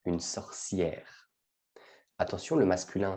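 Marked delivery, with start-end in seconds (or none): 0.0-1.3 "Une sorcière" said slowly and softly; slow model word. Shown as a demonstration. Teacher slowly modelling "sorcière" for French learners. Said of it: "on parle souvent de la sorcière".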